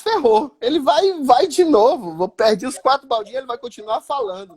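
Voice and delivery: retold alarm. Goalkeeper sees the drunk is back with beers, mock panic. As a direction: act out realising you're doomed. Acting out his alarm at the heckler.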